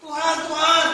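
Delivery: loud pleading cry; mock pleading. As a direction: cry out like a beggar. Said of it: "gitu kan".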